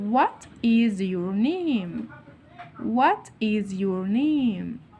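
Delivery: WHAT IS YOUR NAME, each word separated and stretched; slow modelling. Shown as a demonstration. Teacher shows the alternative question to pupils, slow and word by word.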